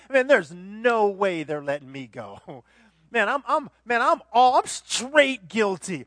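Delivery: imagined Barabbas. Pretend. Barabbas despairing, laughing.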